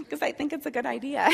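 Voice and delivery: real laugh breaking through the words; laughing at herself. Real, not acted. Admitting to the group she gets frustrated, laughing. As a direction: chuckle at your own stubbornness.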